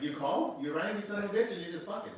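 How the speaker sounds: high, loud annoyed deity; God snapping at a caller; angry God voice. Acted.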